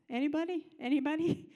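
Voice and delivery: calling out. Retold or imitated. Calling out for a surgeon.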